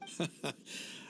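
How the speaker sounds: very quiet breathy chuckle; soft amused huff of laughter. Acted. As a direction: let out a small, hushed laugh. Amused by friend's explanation.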